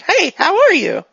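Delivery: high, loud, bouncy hello; cheery reset greeting. Acted. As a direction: snap back into an oblivious, friendly greeting. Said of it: "returns to normal".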